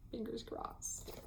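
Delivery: soft slow whisper. Real, not acted. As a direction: breathe it, hoping. Hopeful aside, hushed.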